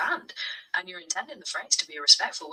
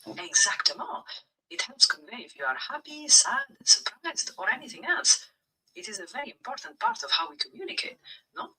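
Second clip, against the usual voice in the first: put-on English spoken with a light French accent; 4 semitones higher than usual. Chatbot answering the streamer's request, in a French accent. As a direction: play a French-born speaker talking English, with a French accent. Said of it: "speak English within a French accent".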